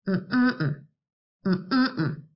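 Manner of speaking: three short closed-mouth hums; hummed syllables. Shown as a demonstration. Teacher hums banana for the class. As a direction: hum each syllable of the word. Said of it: "let's try humming the words".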